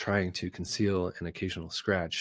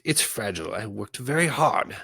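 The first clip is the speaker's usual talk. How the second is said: fretful whine; 4 semitones higher, 6 dB louder than usual. Acted. Cineas fretting over proclamation.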